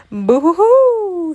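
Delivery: high, loud, drawn-out whooping shout; shouted boo. A narrator's reading. Tigger bursts in to scare Piglet, shouting. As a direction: whoop it long to startle. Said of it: "shouted the bone-bedeckled feline".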